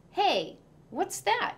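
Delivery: high, slow curious exclamation; boy surprised to see a box. A narrator's reading. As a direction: notice something odd. Spotting the box.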